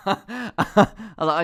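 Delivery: laughing out the words, high and slow. Real, not acted. Recalling the lecturer's question, laughing. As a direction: break into laughter mid-story.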